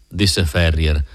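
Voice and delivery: ordinary delivery, nothing marked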